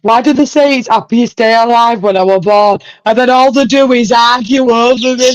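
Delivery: put-on high, loud whiny kid complaining; upset kid from the video. Streamer quoting the funny video kid, whiny shouting kid. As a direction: mimic the kid ranting about his parents. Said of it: "God, that kid".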